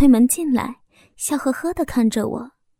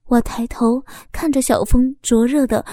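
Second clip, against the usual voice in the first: ordinary delivery, nothing marked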